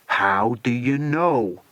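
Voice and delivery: slow, lightly voiced menacing boss; villain boss. Slade to his henchman on the phone, slow lightly menacing. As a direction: press him slowly.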